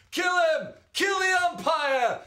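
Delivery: high, loud furious yell from the stands; enraged fan howling at the umpire; angry fan shout. Acted. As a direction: scream for the umpire's blood. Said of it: "someone shouted from the stands".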